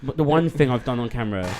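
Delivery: ordinary talk, nothing marked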